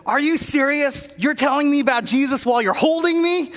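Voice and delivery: an opposing lineman, grabbed, protests in disbelief; incredulous outburst. Retold or imitated. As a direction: snap back in outraged disbelief. Lineman's angry retort in story.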